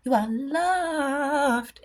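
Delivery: slow and high, stretching the word loved; a slow, sung-out tender reassurance; tender blessing. Real, not acted. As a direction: lay it on gently and slowly like a blessing. Blessing the viewers.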